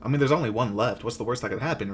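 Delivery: ordinary talk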